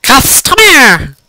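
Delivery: loud drawn-out yell. Acted. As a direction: bellow the word out slowly. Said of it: "she yells".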